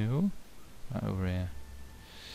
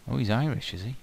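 ordinary delivery

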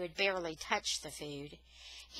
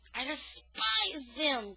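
slow, higher, heated cry; cried out; 5 semitones higher, 4 dB louder, slower than usual. A narrator's reading. Daniel defying the men who held him, slow heated cry. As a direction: spit out the hatred slowly. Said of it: "cried Daniel".

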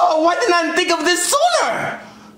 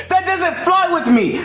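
ordinary delivery

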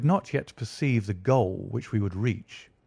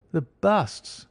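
a mild startled exclamation, pitched up; surprised cry; 7 semitones higher than usual. Acted. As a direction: blurt it out, caught off guard. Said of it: "cried Lestrade".

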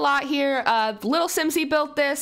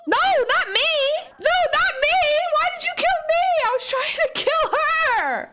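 much higher, loud shrieking protest; horrified scream; 4 dB louder than usual. Real, not acted. A gamer whose own sim gets killed by mistake, shrieking in horror. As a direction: scream in disbelief as your own character dies.

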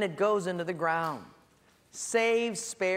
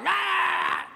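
high, loud hammed-up yell; a husband's high, loud comic yell at his wife; mock yell; 13 semitones higher, 5 dB louder than usual. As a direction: blast a shout at the wife. Yelling at the wife.

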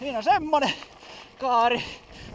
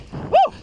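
startled gasp; 5 dB louder than usual. Real, not acted. Rider lands a jump.

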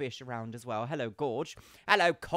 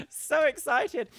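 gushing excited; 12 semitones higher, 9 dB louder, much slower than usual. Real, not acted. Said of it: "So I'm very excited".

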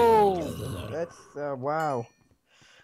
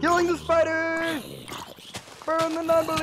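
mock battle cry; 8 semitones higher than usual.

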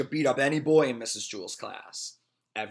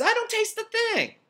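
put-on upset girl voice; 6 dB louder than usual. Morisa voiced in story.

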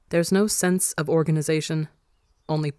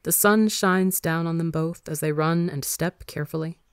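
plain reading voice

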